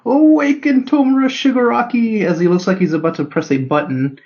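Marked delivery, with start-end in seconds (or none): 0.1-2.2 high, pleading villain cry; a mad scientist's last gamble; desperate command. Retold or imitated. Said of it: "in desperation, the doctor goes".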